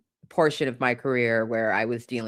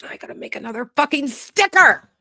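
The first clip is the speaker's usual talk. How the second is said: high, loud frustrated yelp; half-laughing squeal of frustration; exasperated outburst; 13 semitones higher, 5 dB louder than usual. Real, not acted. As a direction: blurt it out, fed up and loud. Exasperated outburst over stickers.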